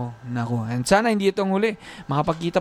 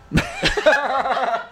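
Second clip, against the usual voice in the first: shrieking laugh; 9 semitones higher than usual. Real, not acted. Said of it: "parang napatili si [name]".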